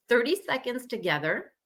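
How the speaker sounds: normal talking voice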